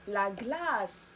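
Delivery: "لا غلاس" said slowly with a long aa and a clear final s; model pronunciation. Shown as a demonstration. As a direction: stretch "غلاس" and release a distinct s at the end. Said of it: "مثال". (نطق تعليمي بطيء لكلمة "لا غلاس" مع سين واضحة)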